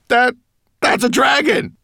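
high, loud panicked stutter; terrified stammer. Acted. Frightened man stammering that he sees a dragon. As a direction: choke out the words in fright.

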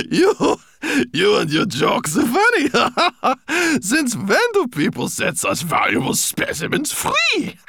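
high, loud, slow laughing words; laughing mockery. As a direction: laugh through the reply. Rosenberg laughing at a joke.

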